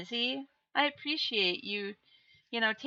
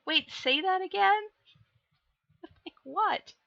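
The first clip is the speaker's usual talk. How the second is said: high puzzled boss; 9 semitones higher than usual. Retold or imitated. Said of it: "And she's like".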